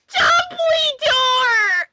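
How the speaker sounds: high, slow, loud announcing yell; dramatic reveal shout. Acted. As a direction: shout the name as a big reveal.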